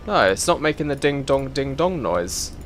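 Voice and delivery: ordinary talk, nothing marked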